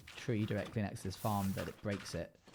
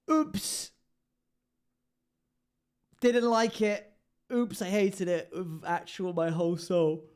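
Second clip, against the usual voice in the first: high, loud hammed-up outrage; mock outraged yell; 8 semitones higher, 8 dB louder, slower than usual. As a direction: yelp it out, over the top. Loud mock outburst at building.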